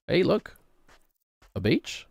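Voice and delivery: ordinary talk, nothing marked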